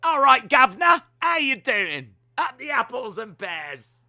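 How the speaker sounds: put-on loud, high Cockney British accent; hammed-up London geezer in a British accent. Asked for a British accent, a loud Cockney. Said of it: "Do a British accent".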